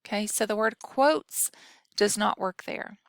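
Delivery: usual speaking voice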